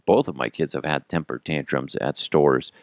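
ordinary delivery

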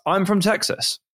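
mock yell, pitched well up; retold shout. Bloke from the toilet announcing himself to the bar, mock yell. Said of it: "walks in from the toilet and shouts".

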